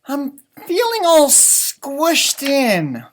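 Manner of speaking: high, slow, loud sighing rabbit voice. Acted. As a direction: stretch it out like a fed-up little rabbit. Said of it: "sighed Rabbit".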